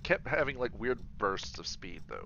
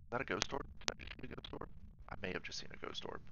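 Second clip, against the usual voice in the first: whispering; 3 semitones higher, 8 dB quieter, faster than usual. Real, not acted. Spotting an orb, hushed.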